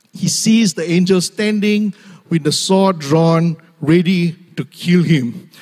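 ordinary talk

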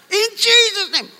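high-pitched mock shout; shouted prayer. Shown as a demonstration. Preacher mimicking those who must shout prayers, mock shouting. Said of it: "We must shout".

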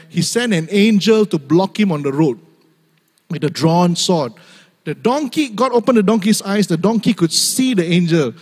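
ordinary talk

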